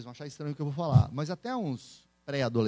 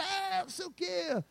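mock audience chatter; 13 semitones higher, 6 dB quieter, slower than usual. Audience after his talks.

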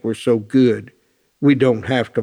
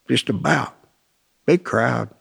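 usual speaking voice

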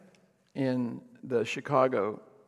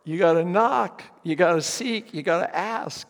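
louder and higher, driving each verb home; urgent exhortation; 4 semitones higher, 6 dB louder than usual. Real, not acted. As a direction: preach it: knock, seek, ask. Preacher urging the congregation.

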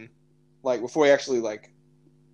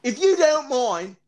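high-pitched, hammed-up Australian accent; 10 semitones higher than usual. Joking plea to podcast listeners, exaggerated Australian accent. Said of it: "I'm gonna do an Australian accent".

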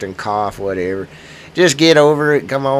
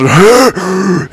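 mock gasp; 7 semitones higher, 10 dB louder than usual. Shown as a demonstration. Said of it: "sounding like I'm having phone sex".

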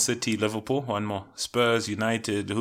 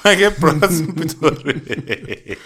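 slow, through laughter; laughing; 5 semitones higher, 7 dB louder, slower than usual. Retold or imitated. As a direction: repeat it cracking up. Repeating friend's line laughing.

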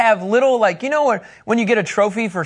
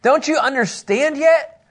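Jesus rebuking; 6 semitones higher, slower than usual. Retold or imitated. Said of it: "It continues on in verse nine".

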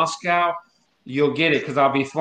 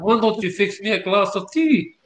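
higher-pitched mock Russian-British accent; 5 semitones higher than usual. A Russian Brit asking for tea, in a higher put-on accent. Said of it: "a Russian Brit".

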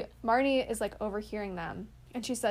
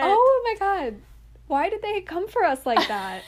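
much higher and louder, cracking up in disbelief; excited outburst; 9 semitones higher, 10 dB louder than usual. Real, not acted. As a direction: blurt it out, delighted and scandalised. Laughing outburst at a movie line.

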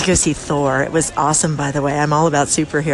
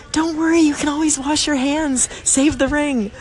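reassuring; 9 semitones higher than usual. Retold or imitated. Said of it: "I was like".

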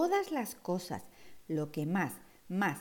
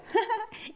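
high giggle; 13 semitones higher than usual. Acted. Giggle at crocodiles.

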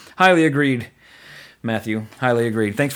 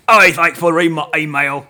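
a hammed-up Cockney, a bit louder; mock Cockney accent; 3 semitones higher, 7 dB louder than usual. Podcast host reads a listener's email in a mock Cockney accent. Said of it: "I have to do, like, a Cockney".

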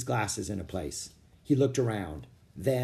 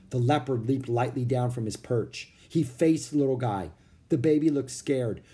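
ordinary delivery

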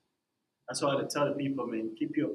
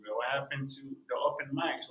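ordinary talk

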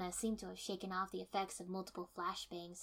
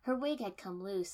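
ordinary delivery, nothing marked

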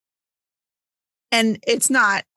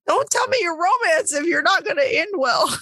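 higher-pitched, indignant plea; exasperated protest; 8 semitones higher than usual. Acted. Reader scolding a book sold as romance, exasperated. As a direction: complain in a raised pitch, mock-outraged.

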